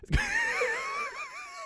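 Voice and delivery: high squealing burst of laughter. Real, not acted. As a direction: shriek with laughter.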